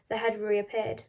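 ordinary delivery, nothing marked

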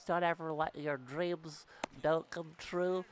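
ordinary talk, nothing marked

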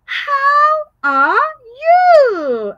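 high, loud sung-out question; asking question. Shown as a demonstration. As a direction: ask the class brightly. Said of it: "I'm asking you".

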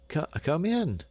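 high, startled stammer. A narrator's reading. Dame Brinker answering the door, stammering. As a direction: trip on the first word, high-pitched. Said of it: "stammered Dame Brinker".

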